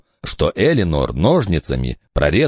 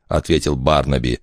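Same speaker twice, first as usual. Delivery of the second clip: usual speaking voice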